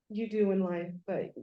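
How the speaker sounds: normal talking voice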